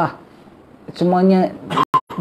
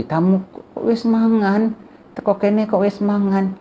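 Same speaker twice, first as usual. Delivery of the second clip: higher, slow, put-on voice of a host scolding in Javanese; miffed Javanese host; 6 semitones higher, slower than usual. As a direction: mimic a host offended that the guest has eaten. Angry Javanese host quoted. (suara tuan rumah Jawa yang merajuk)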